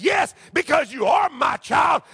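ordinary delivery

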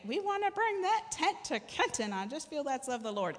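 high-pitched preacher imitation; Mark Dunphy. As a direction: start way up high, mimicking him, then ease down. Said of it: "And he'll say".

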